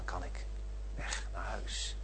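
hushed whisper, slowed down. Acted. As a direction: breathe it like a tired watchman's secret. Nightwatchman longing to go home.

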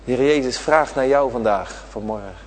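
ordinary talk

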